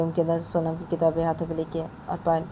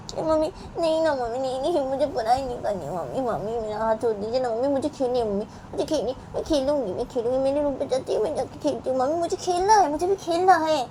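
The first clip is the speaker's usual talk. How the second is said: put-on high, pleading little girl voice; whiny child; 8 semitones higher than usual. Sonam begging her mother to play, high and whiny. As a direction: beg mummy to let go and let you play.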